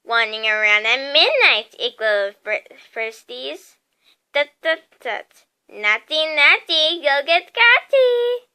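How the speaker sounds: gleeful poltergeist teasing, loud and singsong; mischievous mock scolding; cackling taunt. A narrator's reading. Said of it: "Peeves cackled".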